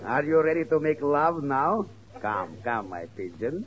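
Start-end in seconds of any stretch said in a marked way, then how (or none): 2.2-3.7 mock seductive. Pirate woos the wife again.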